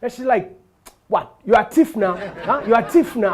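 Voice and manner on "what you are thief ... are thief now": loud accusing Nigerian woman, mock outrage; hammed-up accusation from a tall woman. Tall woman in elevator accusing him, loud mock outrage.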